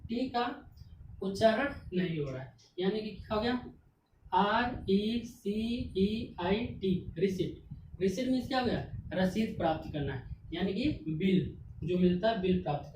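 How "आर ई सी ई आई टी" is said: slow letter spelling. Shown as a demonstration. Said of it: "teacher spells word letter by letter".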